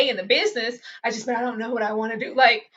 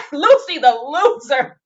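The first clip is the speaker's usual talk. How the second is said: slow, laughing taunt, louder; laughing mockery; 4 semitones higher, 9 dB louder, slower than usual. Real, not acted. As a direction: cackle out the nickname. Mocking Lucy while laughing.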